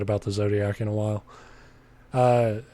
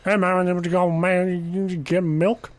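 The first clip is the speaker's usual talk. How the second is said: higher, lazy Louisiana drawl, imitated; Louisiana accent; 6 semitones higher, slower than usual. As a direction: do the regular's thick Louisiana talk. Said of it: "that's the best I could do".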